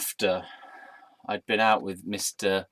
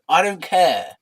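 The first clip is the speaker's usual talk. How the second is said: raised, louder dismissive retort; loud dismissal; 6 semitones higher, 7 dB louder, slower than usual. Acted. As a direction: shout over the critic. Cuts off imagined objector.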